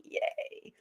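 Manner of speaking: very high, squeaky mock cheering; mock celebrating fan. Mocking Arsenal fans celebrating, squeaky cheer. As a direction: lampoon giddy fans cheering. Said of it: "they ever try and celebrate".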